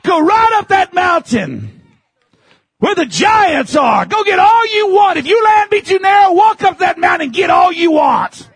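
Joshua's challenge. Retold or imitated. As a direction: hurl the dare up the mountain. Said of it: "he told him".

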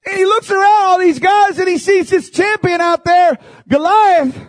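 high, loud, impassioned preacher's cry; full-force preaching. Acted. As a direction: preach it out to the congregation with fire. Preaching David story at full force.

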